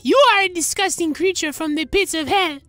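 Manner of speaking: ordinary talk, nothing marked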